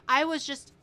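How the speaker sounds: normal talking voice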